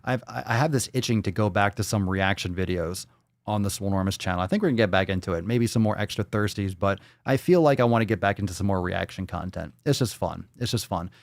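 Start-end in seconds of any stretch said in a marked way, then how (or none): none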